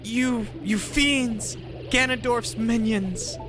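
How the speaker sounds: high-pitched captive in indignant distress; alarmed captive. Acted. Captive character cries to Cody for help against witches, high alarmed voice. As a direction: act out a high-voiced character being dragged off.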